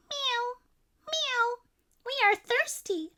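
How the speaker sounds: squeaky little cats begging; crying cats. A narrator's reading. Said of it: "cried the hundreds of cats".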